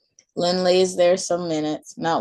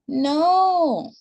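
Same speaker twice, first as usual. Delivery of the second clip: put-on high, stubborn child's no; a toddler digging in her heels; little girl refusing; 11 semitones higher, 3 dB louder than usual. A narrator's reading. Maylee refusing her mom, high child voice.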